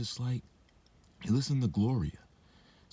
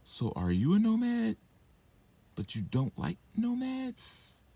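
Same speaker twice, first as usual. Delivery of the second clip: incredulous high questions. Real, not acted.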